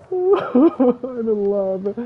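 high, loud, long-drawn wail of delight; overcome gushing. Real, not acted. Player smitten with the new panda, overcome gushing. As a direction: wail it slowly, overwhelmed with love.

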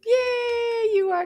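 high, loud, held cheer; big long "yay" for the class; excited cheer. Pretend. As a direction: celebrate the right answer loudly. Cheers children's correct answer.

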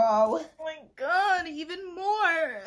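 slow, high, teary oh my god; overcome. Real, not acted. Reacting to the book, slow and teary. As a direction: let it catch in a near sob. Overcome after reading a passage.

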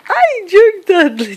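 slow, high, adoring coo. Real, not acted. Adoring the baby.

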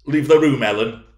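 loud, high command falling at the end; furious order. Acted. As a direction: order her out in a rage. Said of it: "trembling all over".

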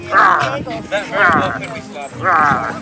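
playful rawr. Pretend. Playful growl.

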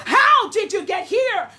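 loud searching question; Spirit asking. Acted. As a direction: call it out as the Spirit. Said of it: "saith the Spirit of the living God".

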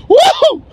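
high loud whoop of delight; joyful whoop. Real, not acted. Flier to viewers, a high whooping cheer. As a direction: let out a big happy cheer.

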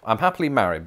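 ordinary talk

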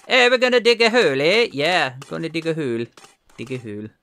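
a hammed-up Canadian accent, high and fast at first; mock Canadian accent that fades out; bad Canadian accent. Voicing the diggers as Canadian Mounties in a terrible Canadian accent. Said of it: "That's a terrible attempt at a Canadian accent".

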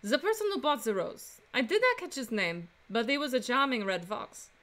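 put-on Frenchwoman's French accent; female French accent. Flower seller answering a defense attorney, female French accent. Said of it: "do another female French accent".

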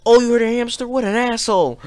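high-pitched mock viewer complaint; put-on indignation, pitched well up; mock outraged commenter. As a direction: imitate an offended commenter in a high voice. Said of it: "people are gonna say that in the comments".

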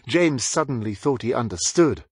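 ordinary delivery, nothing marked

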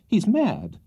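high-pitched distressed protest; agonized. A narrator's reading. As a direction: blurt it out in exasperated alarm. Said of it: "said the agonized Mister Pickwick".

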